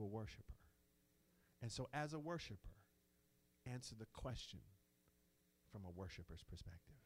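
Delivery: subdued, deliberate pulpit hush; hushed reverence. Real, not acted. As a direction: drop right down into humble, measured quiet. Hushed turn toward a worshiper's answer.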